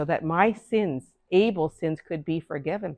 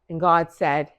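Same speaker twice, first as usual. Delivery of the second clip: usual speaking voice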